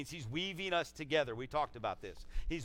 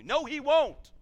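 much higher, loud outburst; emphatic rebuttal; 12 semitones higher, 11 dB louder, slower than usual. Real, not acted. Preacher refuting the idea that God leaves, emphatic shout. Rebuts the belief just quoted.

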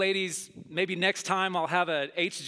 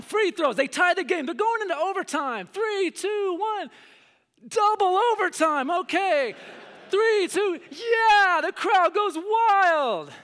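much higher, louder, slow dramatic commentary; excited play-by-play; 12 semitones higher, 7 dB louder, slower than usual. Pretend. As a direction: call overtime like a frenzied announcer. Pretend play-by-play overtime.

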